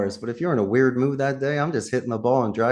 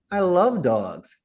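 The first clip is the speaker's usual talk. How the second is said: mock gushing; 5 semitones higher, much slower than usual. Shown as a demonstration. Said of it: "Just don't say that".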